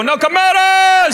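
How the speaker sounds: a high, loud, slow shout of the band name; shouted band call. Retold or imitated. Band greeting the wife, shouts the name high and slow. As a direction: call it out like the band's big chorus. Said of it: "And they go".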